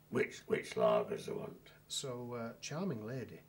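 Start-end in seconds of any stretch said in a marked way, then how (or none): 0.1-1.7 much lower, slow gruff barmaid voice. Retold or imitated. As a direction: drop deep and growl it like a surly barmaid. Gruff barmaid answering the lawyer.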